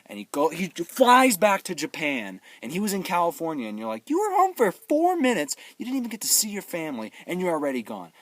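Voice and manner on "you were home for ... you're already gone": exasperated viewer's protest; mock indignation. Viewer objecting to the son leaving, mock indignation. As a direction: start high and loud, then ease down.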